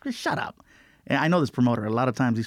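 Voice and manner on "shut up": breathless, whispered shut up; whispered disbelief. Retold or imitated. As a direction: hush it out, too stunned to believe it. Disbelief at good news.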